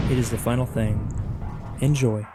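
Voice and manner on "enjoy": ordinary delivery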